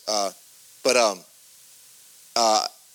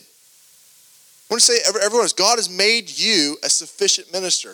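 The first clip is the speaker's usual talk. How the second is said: emphatic preaching; 4 semitones higher than usual. Acted.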